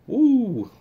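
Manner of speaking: high, loud "woo"; whoop. Real, not acted. Streamer reacting to live chat, high loud whoop.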